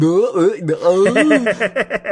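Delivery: high, dopey "uh" noises; self-mocking oafish grunts. Host comparing himself to an articulate colleague, self-mocking dumb grunts. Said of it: "that's the way I sound".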